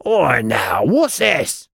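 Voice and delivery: high-pitched goblin outburst; startled goblin, rising to a near shout; nearly shouting goblin. A narrator's reading. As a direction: yelp it like an affronted goblin guard. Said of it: "The thing nearly shouted".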